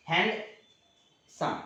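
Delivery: Handsome in two separate beats, long pause between; slow teaching demo of handsome, syllable by syllable; split into syllables. Shown as a demonstration. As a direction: break handsome apart as hand, then सम. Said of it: "दो बार में बोलाता है".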